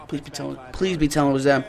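soft, low begging; hushed plea. Real, not acted. Gamer hoping the other side jumped, hushed pleading. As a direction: plead under the breath.

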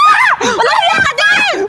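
slow, high angry shout. Acted. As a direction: hurl the insult back slowly. Acted quarrel, shouted back.

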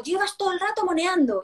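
put-on high, exasperated scolding of the child. Mother scolding her child over spilled cocoa, exasperated. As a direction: snap at the child, fed up. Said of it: "entonces le digo".

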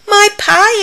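high, loud sudden cry; excited exclamation. Acted. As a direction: burst out as she remembers her pie. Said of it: "exclaimed Amelia Bedelia".

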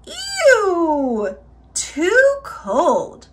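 high, drawn-out "ew" of distaste; disgusted exclamation. A narrator's reading. Aluki sipping the middle-sized bowl, disgusted. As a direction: recoil in storybook disgust, pitched high.

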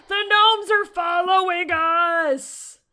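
high, very loud, drawn out; long wailing cry of alarm; panicked shout. Real, not acted. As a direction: shriek the alarming news. Notices gnomes following.

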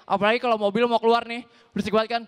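normal talking voice

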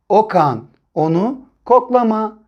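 normal talking voice

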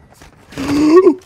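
loud shocked gasp; sharp gasp. Real, not acted. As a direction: suck in air in shock. Sudden shock in game.

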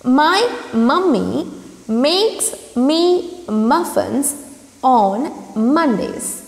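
each word slow and separate; slow demo reading. Shown as a demonstration. Teacher reading slowly to students, clearly paced. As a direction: read it out slowly for the class. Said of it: "the first tongue twister for you is".

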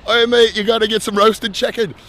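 put-on loud, high-pitched Australian accent. Local urging a visitor to eat, in a put-on Australian accent. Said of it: "they don't have Australian accents".

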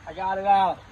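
loud, relieved I got it out; triumphant. Real, not acted. Chip finally out of tooth.

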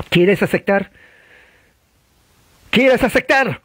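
high-pitched, insistent revivalist demand; urgent altar-call question; pressing preacher. Acted. Acting preacher pressing for decision.

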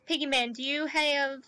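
ordinary talk

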